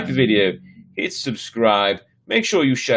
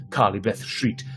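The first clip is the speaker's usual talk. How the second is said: ordinary delivery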